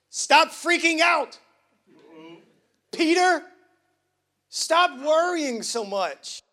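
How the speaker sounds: Jesus firmly telling Peter to stop worrying, loud; emphatic. Acted. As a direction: snap Peter out of his panic. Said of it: "This is an emphatic Matthew Thor translation".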